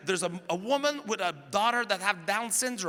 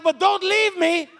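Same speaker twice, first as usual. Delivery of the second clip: high, loud, slow pleading whine; whiny plea; 12 semitones higher, 8 dB louder, slower than usual. Retold or imitated. Comedian's wife begging him to stay, loud whine. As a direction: beg him back like a child. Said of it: "She's like".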